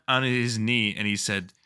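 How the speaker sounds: ordinary talk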